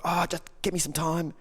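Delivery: high-pitched mock exasperation; exasperated plea. Upset woman to her man, exasperated plea. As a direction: plead for room in a high voice. Acts exasperated partner pushing him away.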